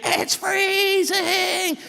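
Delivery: very high, slow squeal; shocked cold swimmer. Retold or imitated. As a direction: stretch the squeal, the cold is unbearable. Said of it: "knee deep".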